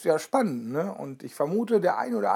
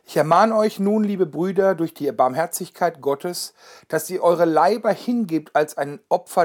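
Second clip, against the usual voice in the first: solemn, louder Bible reading; scripture reading; 5 dB louder than usual. Acted. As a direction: proclaim the verse to the congregation. Reads the Bible verse aloud.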